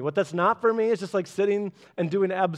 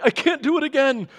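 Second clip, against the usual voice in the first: mock weary God, higher and louder; playing God worn out after one more jar; tired God; 9 semitones higher, 7 dB louder than usual. Preacher voicing a tired God, raised and louder. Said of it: "God wasn't like".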